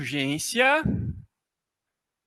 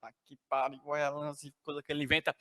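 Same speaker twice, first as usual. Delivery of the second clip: much softer, lower grumbled aside; muttering aside; 7 semitones lower, 16 dB quieter, much faster than usual. Retold or imitated. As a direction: grumble it under your breath. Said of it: "A produção já tá assim".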